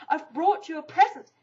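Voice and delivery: eager, bouncy Pooh; excitedly. A narrator's reading. Pooh tells Eeyore excitedly about his present. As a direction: burst out with it, excited. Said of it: "said Pooh excitedly".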